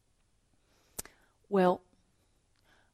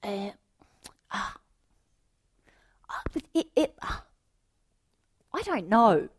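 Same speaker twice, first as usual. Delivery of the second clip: put-on hesitant boy; 3 semitones higher than usual. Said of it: "He went".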